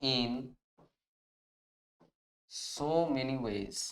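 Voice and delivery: word by word with long pauses; slow dictation. Shown as a demonstration. Teacher dictating the answer to students, slow word by word. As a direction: spell out each word slowly so students can write it. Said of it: "তাহলে আমরা এটা কিভাবে লিখবো?".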